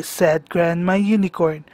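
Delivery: ordinary delivery, nothing marked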